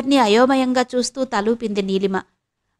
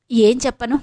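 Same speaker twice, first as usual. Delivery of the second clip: ordinary delivery, nothing marked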